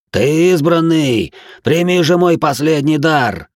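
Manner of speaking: high, loud, slow ecstatic cry; mad exultation. Acted. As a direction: proclaim it like a mad prophet. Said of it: "ликовал".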